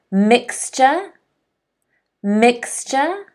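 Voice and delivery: "Mixture" said slowly and separately, rising each time; model pronunciation. Shown as a demonstration. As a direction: offer "Mixture" with a lift for learners to echo. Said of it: "Can everyone say mixture".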